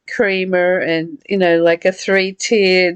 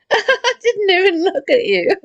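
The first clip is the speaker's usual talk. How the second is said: higher, laughing as she speaks; laughing apology; 10 semitones higher than usual. Real, not acted. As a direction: giggle through the apology. Friend sneezing during reading.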